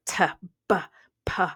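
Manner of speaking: t, b, p said as hard isolated plosive sounds. Shown as a demonstration. Teacher to students, sounding out hard plosives t, b, p. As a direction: spit out each hard sound. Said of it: "a plosive is a hard sound like".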